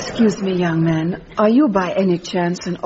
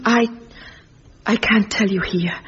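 whisper. Acted. Secretive reunion, can't say here.

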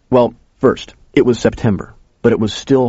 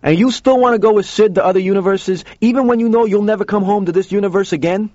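high, insistent questioning voice; Joe, challenging; 11 semitones higher than usual. A narrator's reading. Joe testing whether the kid still wants to go, challenging. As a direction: press the question on a sustained high pitch.

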